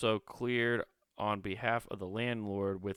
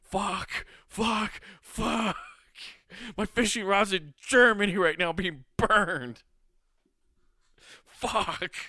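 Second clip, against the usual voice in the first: mock despair; 9 semitones higher, 5 dB louder, slower than usual. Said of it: "you're just like".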